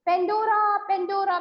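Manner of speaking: high, sustained sing-out of the name; distant voice calling. A narrator's reading. A mysterious voice summons Pandora, distant calling. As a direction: call it out long, as if from far away. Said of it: "distance voice calling".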